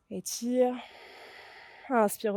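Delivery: ordinary delivery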